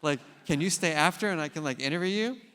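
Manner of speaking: hurried and thrilled, can't wait; retold excitement. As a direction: speaker begging the superintendent to stay, excited. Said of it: "I'm like".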